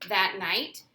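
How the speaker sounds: usual speaking voice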